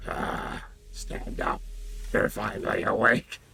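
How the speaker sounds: gravelly undead Dunmer; lightly voiced undead elf. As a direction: rasp it out like a corpse waking. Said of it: "let me gargle some gravel".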